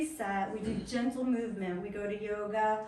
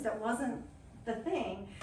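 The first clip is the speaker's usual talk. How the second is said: normal talking voice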